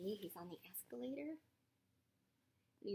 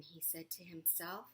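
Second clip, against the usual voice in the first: plain reading voice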